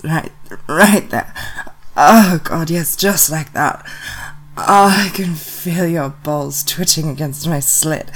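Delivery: loud, breathless, urgent pleading; loud urgent pleas. Acted. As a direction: push every word out loud and urgent. Urgent pleas near the climax.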